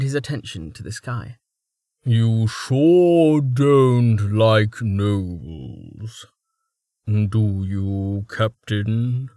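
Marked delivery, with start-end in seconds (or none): 2.0-9.3 loud teasing. Acted. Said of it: "called Haynes loudly".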